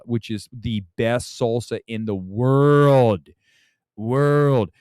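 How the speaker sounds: slow build to a long, loud WORLD; drawn-out emphasis. Pretend. Streamer praising Walmart salsa to viewers, drawn-out emphasis. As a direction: stretch "world" out loud, then repeat it.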